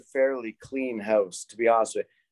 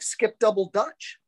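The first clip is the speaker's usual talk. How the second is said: higher, slower repeat for a listener; clearer repeat; 9 semitones higher, slower than usual. Real, not acted. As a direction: spell it out for someone who missed it. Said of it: "And what?".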